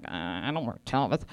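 deadpan disdain. Retold or imitated. Dismissive quote to wardrobe.

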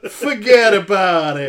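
loud, brash New York catchphrase; thick New York accent. Acted. Host warming up to read a listener's comment, loud New York accent. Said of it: "Thick New York accent".

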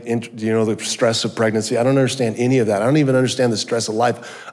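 ordinary delivery

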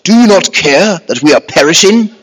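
loud, high mock-posh English voice. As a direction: put on a very posh English accent. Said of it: "which sounds very posh".

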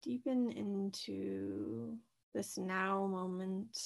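soft, unhurried meditation voice with long pauses; guided meditation. Acted. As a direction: gently guide listeners into their breath, leaving space between phrases. Leads a guided breathing meditation.

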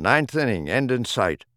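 ordinary delivery, nothing marked

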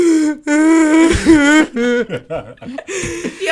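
loud high burst of laughter. Real, not acted. Laughing at herself for sobbing three minutes. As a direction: let a big laugh out.

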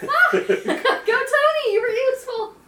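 high, loud laughing cheer; excited cheering. Real, not acted. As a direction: cheer him on at the top of your voice. Cheering a teammate's hit.

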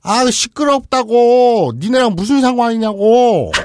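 very slow, loud high yelling; angry yell. Acted. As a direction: stretch every word in fury. 아버지 역 외침. (아주 느린 분노의 고함)

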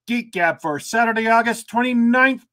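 usual speaking voice

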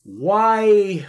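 put-on loud, high Boston accent. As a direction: belt it out in a thick Boston accent. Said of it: "he did, like, a big Boston accent".

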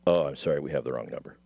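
low, dropping, brushing off the call; low caller voice. Retold or imitated. Retold caller brushing him off.

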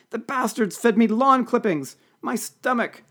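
high, drawn-out wail of distress; pathetic moaning. A narrator's reading. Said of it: "she moaned pathetically".

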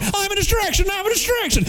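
very high, squeaky chant; mock decoy. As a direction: run around squeaking it as a silly decoy. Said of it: "running, going".

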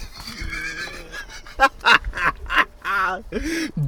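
breathless high-pitched laugh; high giggle. Real, not acted. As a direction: burst into giggles. Laughing at the quote.

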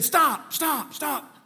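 high-pitched retold cries to halt; retold high, insistent plea; urgent wife. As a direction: imitate a wife urgently calling out. Acting out wife's urgent demand.

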